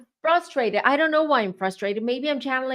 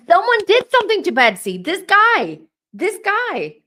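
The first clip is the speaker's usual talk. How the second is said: excited insistence; 10 semitones higher, 7 dB louder than usual. Real, not acted. Psychic insists on a culprit.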